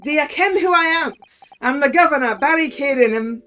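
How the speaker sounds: loud, indignant Scottish governor; Scottish accent. Acted. Governor announces who he is to visitors, Scottish accent. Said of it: "just in time for the Scottish accent".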